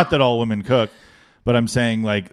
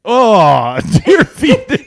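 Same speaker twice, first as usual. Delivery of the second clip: high, loud laughing shock; 10 semitones higher, 9 dB louder, much slower than usual. Real, not acted. Co-host reacting to the story, laughing in shock. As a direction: shriek it in disbelief.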